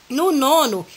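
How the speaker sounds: ordinary delivery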